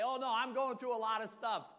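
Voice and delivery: high, loud mock lament; exaggerated woe-is-me whine; mock complaining. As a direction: moan it out like a hammed-up complainer. Acts someone complaining about troubles.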